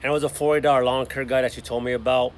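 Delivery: ordinary talk, nothing marked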